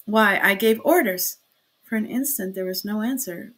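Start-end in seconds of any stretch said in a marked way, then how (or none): 0.0-1.4 louder, irritated protest; indignant that orders were ignored; annoyed. A narrator's reading. Magistrate protesting to those outside, annoyed.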